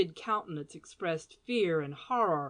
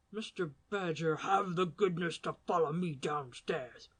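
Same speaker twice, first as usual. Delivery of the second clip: restrained gentleman voice; 4 dB quieter than usual. Acted. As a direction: hold back fear and anger. Mordaunt cold and restrained.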